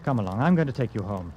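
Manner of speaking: ordinary talk, nothing marked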